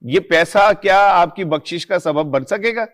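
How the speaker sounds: impassioned, high-pitched moral rebuke; indignant reproach. Real, not acted. Commentator rebukes hotel owners for chasing money, indignant reproach. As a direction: shame them with pointed rhetorical questions.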